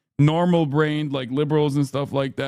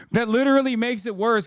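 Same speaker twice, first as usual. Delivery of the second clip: higher, pressing the point; heated disbelief; 7 semitones higher than usual. Real, not acted. Streamer scoffing at the excuse, higher. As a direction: insist it's even worse, voice climbing. Repeats retort, more heated.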